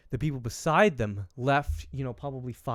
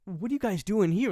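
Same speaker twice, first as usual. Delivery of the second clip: higher-pitched retold disbelief; baffled, pitched-up retelling; incredulous; 8 semitones higher than usual. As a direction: question them in mock astonishment. Retelling his disbelief to teens.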